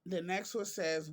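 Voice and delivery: ordinary delivery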